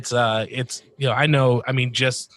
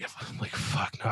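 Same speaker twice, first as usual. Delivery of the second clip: muttered curse; 2 semitones lower, 12 dB quieter than usual. Retold or imitated. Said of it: "And I was like".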